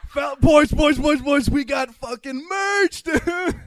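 high and loud, giddy; excited shout. Retold or imitated. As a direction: yell it like a giddy kid. Excited shout about the merch.

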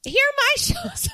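high-pitched brazen butting-in; Sonia. Retold or imitated. Mimicking Sonia plugging her own tour, high and brash. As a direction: pipe up shamelessly to plug yourself.

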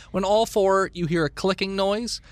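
usual speaking voice

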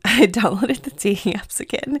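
words breaking up with laughter; laughing confession. Real, not acted. Podcaster admits to listeners she is back on dating apps, laughing. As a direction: admit it through embarrassed laughter.

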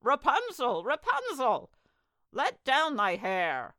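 high, loud singsong call. A narrator's reading. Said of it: "he heard how she cried".

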